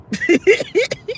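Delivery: high giggling laugh at her own idea; burst of laughter. Real, not acted. Vlogger laughs at her own hat joke, laughing.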